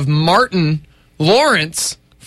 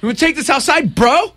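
high-pitched, playful fight challenge; mock tough bro; 9 semitones higher than usual. Host squaring up to co-host for a pretend fight, mock challenge. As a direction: pick a fight as a joke, pitched up.

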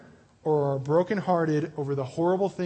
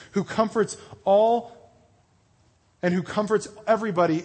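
scripture reading; 2 semitones higher, 4 dB louder than usual. Acted. Preacher reads Corinthians to the youth, proclaiming. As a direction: proclaim the verse to the congregation.

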